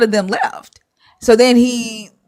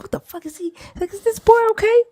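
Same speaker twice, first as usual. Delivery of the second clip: baffled disbelief; 14 semitones higher, 4 dB quieter, faster than usual. Retold or imitated. Disciples baffled by Jesus' words.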